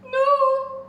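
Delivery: high loud scream of no; terrified no. Acted. Witch fleeing the dragon, a high frightened scream. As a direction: shriek in fright. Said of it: "cried the witch".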